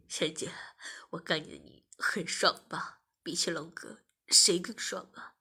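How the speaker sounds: low taunting male character voice; male masseur figure, taunting. A narrator's reading. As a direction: speak low, taunting the listener.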